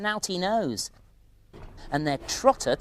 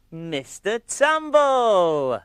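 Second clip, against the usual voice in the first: 1 excited call; 11 semitones higher, 7 dB louder, much slower than usual. Acted. Calling out the show's host.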